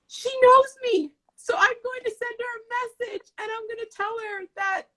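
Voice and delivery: very high, excited squeal; retold losing it. Retelling feeling known by the author, excitedly losing it. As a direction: act out thrilled recognition, way up high. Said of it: "I was losing my mind".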